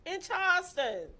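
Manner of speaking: loud, high, emphatic gossip; scandalized reveal. Real, not acted. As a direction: drop each detail like a bombshell. Reveals scandalous detail to friend.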